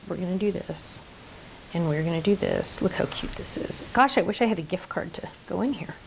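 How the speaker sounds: quiet muttering to herself; grumbling to self. Real, not acted. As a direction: mumble it under your breath. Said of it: "Talking to myself, sorry".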